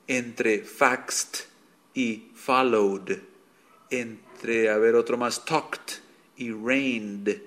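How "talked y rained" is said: very slow, exaggerated talked and rained; slow contrast demo. Shown as a demonstration. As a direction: stretch talked and rained out slowly so the endings differ. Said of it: "el sonido de la E D no es igual". (contraste lento y exagerado de talked y rained)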